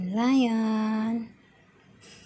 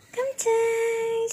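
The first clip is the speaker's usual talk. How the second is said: high cooing call; 10 semitones higher than usual. Real, not acted. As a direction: call the cat up high and sweet.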